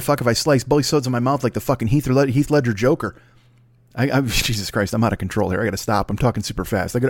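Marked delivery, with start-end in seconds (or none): none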